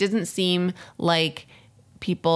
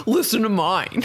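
water boy impression; 5 semitones higher than usual. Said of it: "can you do a water boy impression".

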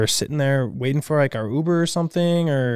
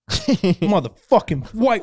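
drawn-out furious slur, pitched up; imitating a man suddenly freaking out; angry outburst; 6 semitones higher, much slower than usual. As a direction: spit it out slow like a stranger turning nasty. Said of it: "He goes".

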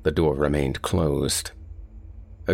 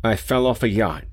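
higher, urgent plea; raised, nervous insistence; anxious repeat; 6 semitones higher than usual. A narrator's reading. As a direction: say it again, pitched up, trying to be understood. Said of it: "Rainsford began again".

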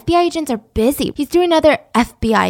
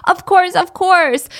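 higher and louder, thrilled disbelief; overjoyed relief; 6 semitones higher, 3 dB louder, slower than usual. Retold or imitated. Girl thrilled the agent lets her go, higher and louder. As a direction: burst out with happy relief. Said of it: "Sally is so freaking happy".

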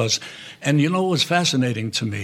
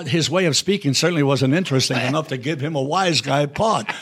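ordinary talk, nothing marked

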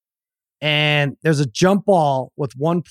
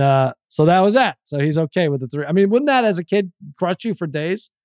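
ordinary talk, nothing marked

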